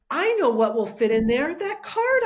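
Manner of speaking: reliving the moment the answer hit her; excited realization. Retold or imitated. Guest recalls finding the card for the frame, excited.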